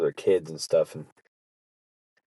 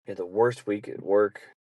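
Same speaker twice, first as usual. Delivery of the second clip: ordinary talk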